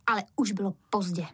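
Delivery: plain reading voice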